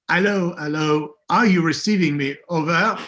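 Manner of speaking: radio call with a hint of a French accent. Sitcom radio contact calling in, French accent. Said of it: "I remember as a sort of French accent".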